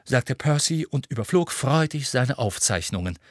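plain reading voice

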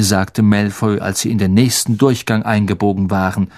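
ordinary delivery, nothing marked

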